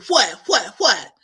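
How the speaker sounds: high loud alarmed whats; startled what. Retold or imitated. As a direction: imitate a startled sister. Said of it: "my sister say".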